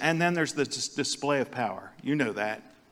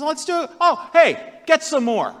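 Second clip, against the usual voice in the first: eager command; 11 semitones higher, 8 dB louder than usual. Retold or imitated. Elijah urging more water poured.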